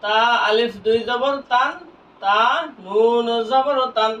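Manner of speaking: slow recital with নুন held long; teacher's slow spelling drill; drawn-out spelling recitation. Shown as a demonstration. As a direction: recite both spellings slowly, letter by letter. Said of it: "তাহলে আমরা কি পড়লাম?".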